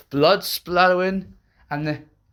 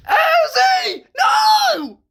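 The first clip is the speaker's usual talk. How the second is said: high, loud piercing scream of a name; 7 dB louder, much slower than usual. Acted. Anne screaming as Uzi is grabbed. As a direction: scream out in horror. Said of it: "screamed Anne".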